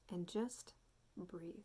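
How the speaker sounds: soft meditation voice with long pauses; guided meditation. Real, not acted. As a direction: gently guide listeners into noticing their breath, unhurried. Guides a breathing check-in.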